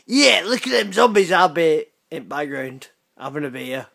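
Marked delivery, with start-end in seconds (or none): 0.1-1.3 higher, louder echo of a friend; mocking imitation. Mimicking the friend's zombie show line, loud mocking voice. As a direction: throw the line back, pitched up and loud. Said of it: "why are you going".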